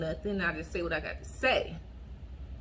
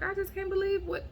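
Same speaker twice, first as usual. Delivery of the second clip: disbelief; 11 semitones higher than usual. Retold or imitated. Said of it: "I'm just like".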